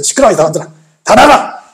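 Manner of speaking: scolding that builds to a loud dismissal; acted stern order. Jesus orders the mockers out. (호통치며 내쫓듯)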